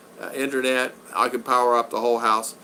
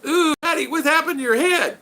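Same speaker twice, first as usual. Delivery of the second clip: put-on much higher, wide-eyed little kid; child's voice. The child asks Daddy about the scar during a haircut, high kid voice. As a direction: play a small child who is both curious and worried. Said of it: "and art goes".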